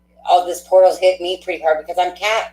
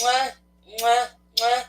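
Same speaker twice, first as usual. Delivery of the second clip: playful kissing sounds sent off to fans; blown kisses; 3 semitones higher, 5 dB quieter than usual. Real, not acted. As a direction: blow the viewers kisses. Blowing kisses to viewers.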